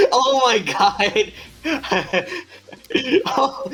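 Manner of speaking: high squealing laughter; cracking up, barely able to speak; laughing hard. Real, not acted. GM cracks up at redneck fairies.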